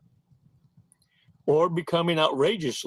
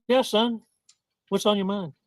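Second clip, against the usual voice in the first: high-pitched mom voice; put-on mother's voice, pitched up; mommy answering her son; 7 semitones higher than usual. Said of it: "She listens".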